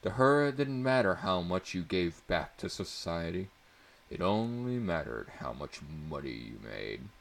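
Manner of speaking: plain reading voice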